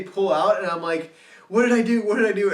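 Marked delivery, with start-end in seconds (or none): 1.5-2.0 loud, high panicked cry, fully in the moment. Retold or imitated. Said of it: "full panic mode". 2.0-2.5 panicked repeat. Retold or imitated. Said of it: "full panic mode".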